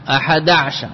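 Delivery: أحد عشر run together, ع closing the syllable before sh; silent ayn. Shown as a demonstration. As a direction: say أحد عشر as "aḥada‘-shar", ayn without a vowel. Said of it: "وقد تسكن عين عشر". (نطق عشر بإسكان العين)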